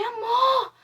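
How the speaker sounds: a panicked call to her husband in the night; retold scream for husband. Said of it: "E aí eu gritei".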